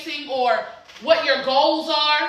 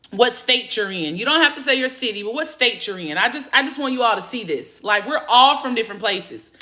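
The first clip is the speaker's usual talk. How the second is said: usual speaking voice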